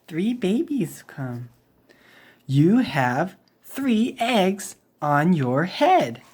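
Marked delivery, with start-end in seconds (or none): none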